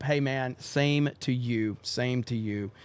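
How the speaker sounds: ordinary delivery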